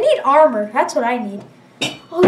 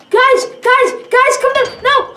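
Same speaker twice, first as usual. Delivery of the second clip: high, loud alarm; raised, panicked gamer yell; urgent calling out; 7 semitones higher, 6 dB louder, slower than usual. Real, not acted. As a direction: shout urgently to teammates. Urgently calling teammates in game.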